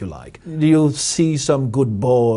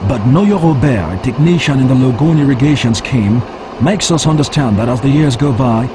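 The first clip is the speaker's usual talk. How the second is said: firm, louder field report voice; news field reporter; 6 dB louder than usual. Acted. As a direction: deliver it like a TV correspondent on location. On-location report after farmer interview.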